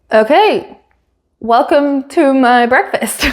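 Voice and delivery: imitating a puzzled, hesitant host; perplexed. Oscar left with the camera at breakfast, mock perplexed. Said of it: "he looked so perplexed".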